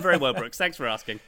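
ordinary talk, nothing marked

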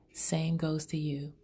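ordinary talk, nothing marked

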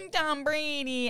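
put-on high-pitched whimpering little kid; mock meltdown. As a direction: imitate a child crying over a lost game. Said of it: "he had an all-out meltdown".

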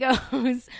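normal talking voice

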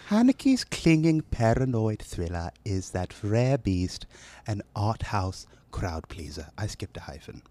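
put-on British accent. Knighted announcer reading a magazine blurb, British accent. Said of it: "listen to a British accent".

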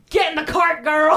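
high, loud playful yell; urgent call. Acted. Urging a girl into the cart, mock yelling.

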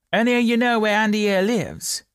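high, loud question to the crowd; calling bobby. A narrator's reading. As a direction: call out to the whole street.

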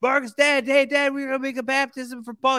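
put-on very high, excited kid voice; hammed-up high-pitched little boy; eager child. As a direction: imitate a boy bursting with news for dad. Voicing eager child Paul to his dad.

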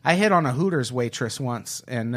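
ordinary delivery

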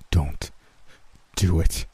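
low, gruff warning; halting, low gruff voice. A narrator's reading. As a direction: warn gruffly, with a pause mid-phrase. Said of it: "Will told me gruffly".

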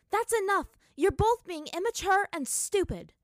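very loud, high scolding yell; furious shout. Acted. Katara yells at Aang and Zuko to stop, furious. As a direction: shout them both down.

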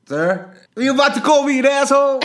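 very high, loud shouted question; hammed-up military shout; recruit answering sergeant. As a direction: yell back like a mock recruit.

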